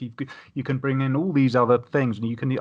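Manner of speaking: usual speaking voice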